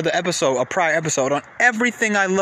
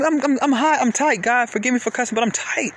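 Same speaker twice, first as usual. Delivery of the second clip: normal talking voice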